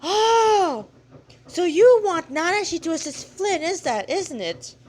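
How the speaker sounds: high, loud exclamation of realization; girl's sudden realization. Acted. Girl realizing what the god wants, high and surprised. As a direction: break in with a bright high Oh.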